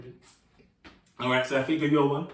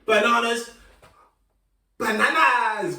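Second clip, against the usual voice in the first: calling out; 6 semitones higher, 3 dB louder than usual. Shown as a demonstration. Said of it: "I'm gonna call them out".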